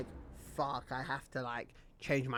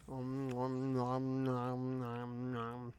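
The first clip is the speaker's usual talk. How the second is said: droning, softer om-nom-nom; playful nom-nom noise; 2 semitones lower, 6 dB quieter, much slower than usual. Pretend. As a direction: hum a run of munching noises, fading. Playful noise, then calls self annoying.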